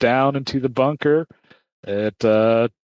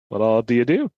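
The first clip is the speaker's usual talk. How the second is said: usual speaking voice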